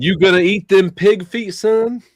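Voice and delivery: loud, high hillbilly twang. Acted. Voicing a hick-town local.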